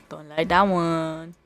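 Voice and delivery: ordinary delivery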